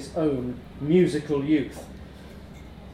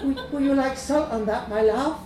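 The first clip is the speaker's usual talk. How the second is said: put-on higher, soft, sweet shop girl; soft Greek girl voice; 9 semitones higher than usual. A narrator's reading. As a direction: coo it like the counter girl. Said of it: "in a soft voice".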